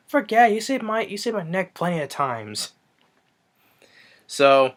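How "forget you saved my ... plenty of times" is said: high-pitched cartoon voice; hammed-up cartoon buddy; cartoon reply. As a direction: toss it back in a high voice.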